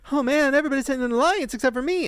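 high-pitched mock lament; exaggerated poor-me complaint, pitched high; desperate and sad. Imitating her coming back into the room, desperate and sad. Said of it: "so desperate and sad".

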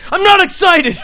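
high, loud mock yell; yelled denial. Angry customer shouting at the manager, mock yell. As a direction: bellow it, denying you're excited. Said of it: "He's yelling at the guy".

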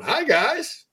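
squeaky high greeting from the mailman; Mister McFeely voice. Pretend. Mister McFeely's graphic greeting the hosts, squeaky high. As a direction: chirp it way up high like a cartoon mailman. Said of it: "a graphic of him going".

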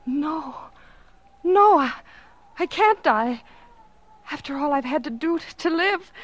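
high, frantic denial of death; clinging to life in panic; desperate pleading. Acted. Dying woman refusing death.

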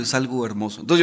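ordinary talk

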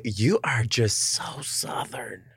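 slow, breathy whisper of delight; whispered aside. Real, not acted. Host marveling at guest's Southern charm, soft whisper. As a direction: murmur it in amused disbelief.

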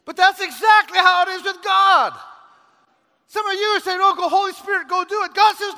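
loud, high, shouted sermon; full-force pulpit preaching; fiery preaching. Acted. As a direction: preach it hard to the congregation.

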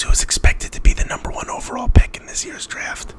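breathy theatrical whisper, no voice; stage whisper. Pretend. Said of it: "Stage whisper".

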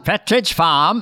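slow New England "Pepperidge Farm"; Boston accent. Shown as a demonstration. Man finding the dialect in one phrase, Boston accent. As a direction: stretch it out with a dropped New England r. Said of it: "get it in one word".

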